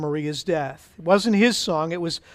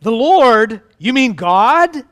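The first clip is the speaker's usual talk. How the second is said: louder, drawn-out disbelief; astonished questioning; 4 semitones higher, 6 dB louder, slower than usual. Acted. Jack learning the voice is God, astonished questioning. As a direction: ask it with mild amazement.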